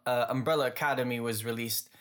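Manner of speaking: ordinary talk, nothing marked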